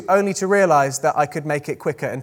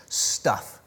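put-on Scottish accent; 8 semitones lower, 7 dB quieter than usual. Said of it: "Should I try and do a Scottish accent?".

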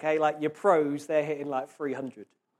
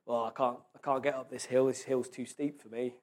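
defeated inner voice; 5 semitones lower, 5 dB quieter than usual. Retold or imitated. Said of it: "we're gonna lose easier next time".

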